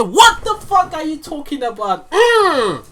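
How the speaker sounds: high, loud amazed outburst; can't believe how good that was; hyped disbelief. Real, not acted. Reactor amazed at the bar, high hyped outburst.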